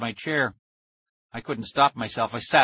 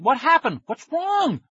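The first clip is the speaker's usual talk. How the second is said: very high, loud panicked questions; alarmed cry; 6 dB louder than usual. A narrator's reading. Kid begging his screaming friend for an answer, panicked cry. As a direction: pitch climbing into a frantic cry. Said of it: "I cried".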